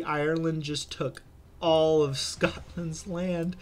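ordinary talk, nothing marked

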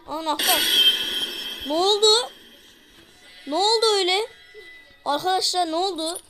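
panicked chase commentary; frantic shouting. Real, not acted. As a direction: yell at viewers mid-chase. Monster angry, chasing player.

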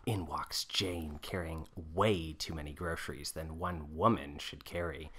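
soft, low, measured narrator; unhurried script narration; stage-direction narration. A narrator's reading. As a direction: read the scene quietly. Narrator again.

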